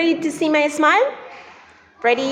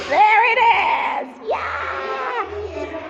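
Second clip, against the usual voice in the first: excited reveal; 7 semitones higher, slower than usual. Pretend. Building to reveal for kids.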